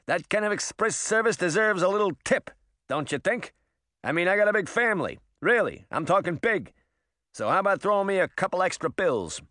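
high, fast-talking hustler rabbit; pushy rabbit. Acted. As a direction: wheedle for a tip, street-smart.

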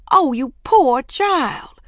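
high, loud, drawn-out pitying cry; sympathetic concern. A narrator's reading. Missus Kidd to rescued Ramona, slow cry of sympathy. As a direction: gush slowly with worried sympathy. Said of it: "filled with sympathy, concern, and relief".

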